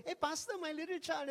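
put-on a cheerful parent offering their child; a hint of a proud parent; eager parent. Parent bringing child to pastor.

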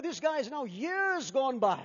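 ordinary talk

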